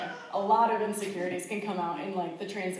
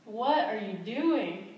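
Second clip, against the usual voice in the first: exasperated disbelief; 4 semitones higher than usual. Retold or imitated. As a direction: retell the frustration, pitched up, slowing at the end. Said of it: "of course I was frustrated and annoyed".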